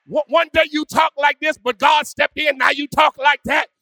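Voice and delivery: ordinary delivery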